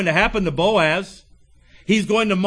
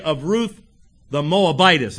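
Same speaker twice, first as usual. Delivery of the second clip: Moabitess set apart after a pause; pause, then punch Moabitess pointedly; stress on Moabitess. Retold or imitated. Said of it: "I don't know if he emphasized that word".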